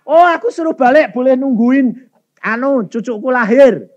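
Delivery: high, loud exclaiming; imitating mother's eager announcement; excited report. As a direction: burst out with the good news. Mother reports permission to return.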